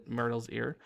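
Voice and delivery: ordinary delivery, nothing marked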